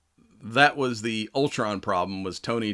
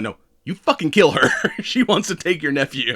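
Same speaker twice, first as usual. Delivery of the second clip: louder, laughing retold order; laughing mock yell; 6 semitones higher, 5 dB louder than usual. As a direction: insist loudly through a laugh. Sue insists, laughing while retelling.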